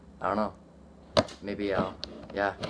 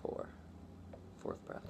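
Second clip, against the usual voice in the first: quiet; 2 semitones lower, 21 dB quieter, faster than usual. Real, not acted. About to hold breath again.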